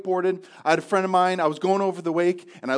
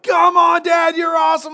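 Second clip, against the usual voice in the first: put-on high, loud cheering children; kids shouting; 11 semitones higher, 8 dB louder than usual. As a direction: yell encouragement from far down the hill. And the kids are like.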